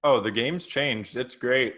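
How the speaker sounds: ordinary delivery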